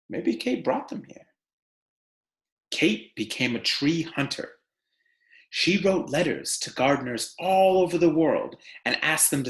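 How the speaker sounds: animated picture-book narration; reading to children. Acted. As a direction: read it to a class of kids, lively and clear. Teacher reads picture book aloud.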